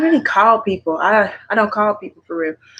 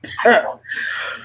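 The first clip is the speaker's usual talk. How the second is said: breathy giggle; 2 semitones higher than usual. Real, not acted. Tipsy vlogger laughs alone.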